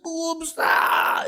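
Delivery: very high mock fan scream; imitated crowd shriek. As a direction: squeal like a mob spotting its idol. Imitates fans spotting Bob.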